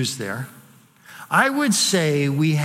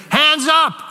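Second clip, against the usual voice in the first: loud shouted hands up, much higher; 10 semitones higher, 5 dB louder than usual. Retold or imitated. Pastor imagines shouting in the mall, loud shout. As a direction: bellow it across the mall.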